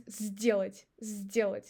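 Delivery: сделать with s said as z; сделать said zdelat; сделать starting with z; voiced s. Shown as a demonstration. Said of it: "we pronounce it as Z".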